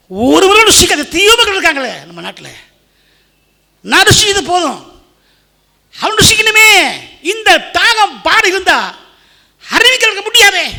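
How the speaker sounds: very high, loud exhortation; shouted, impassioned sermon climax; full-force preaching. Real, not acted. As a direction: cry out to the congregation with burning urgency.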